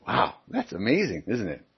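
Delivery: normal talking voice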